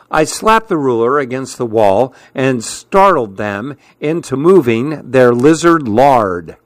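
ordinary delivery

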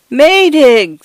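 high, loud cry for his friend; a small voice screaming against the gale; feeble scream. A narrator's reading. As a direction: scream the name into the storm. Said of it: "screamed Mister Fotheringay's feeble voice".

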